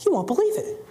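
high, far-off astonished publisher voice; surprised publisher. Retold or imitated.

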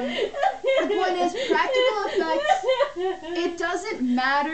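high, loud, through laughter; laughing. Real, not acted. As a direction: push through giggles. Tries to continue while laughing.

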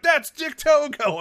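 high, loud and drawn out; amazed exclamation. Retold or imitated. Speaker acts out someone spotting Dick Togo, amazed. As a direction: stretch it into a big retold cry.

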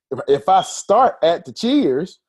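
ordinary delivery

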